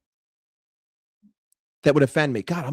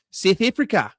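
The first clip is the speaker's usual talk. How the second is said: attempted South African accent; a stab at a South African accent. Shown as a demonstration. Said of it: "it overlaps a lot with South African".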